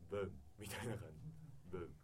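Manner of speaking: "ブン" with an obscure schwa vowel; soft, slurred "ブン" ending; reduced ending. Shown as a demonstration. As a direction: say "ブン" lax and unstressed. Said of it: "語尾は曖昧になります".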